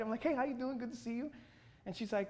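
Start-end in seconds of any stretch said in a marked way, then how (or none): 0.2-1.3 excited greeting. Retold or imitated. Job applicant greeting the interviewer, excited. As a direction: greet them with keen, lifted pitch. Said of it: "I'm all excited".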